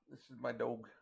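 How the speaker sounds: ordinary talk, nothing marked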